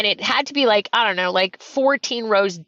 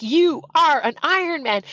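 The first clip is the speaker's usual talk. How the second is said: higher, emphatic finish-line proclamation; Mike Riley; 6 semitones higher than usual. Retold or imitated. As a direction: proclaim it like a race announcer. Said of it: "He's like".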